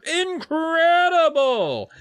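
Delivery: high, loud, stretched wonder; amazed cry. Acted. Dad marveling at the frozen snowman, high and loud. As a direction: gush it in awe. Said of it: "Dad cried".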